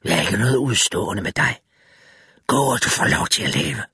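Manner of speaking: low, cold voice of the drowned thing; low dead-man voice offering mercy; creature voice. A narrator's reading. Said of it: "sagde væsenet".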